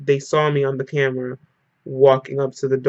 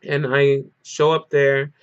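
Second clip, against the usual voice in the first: normal talking voice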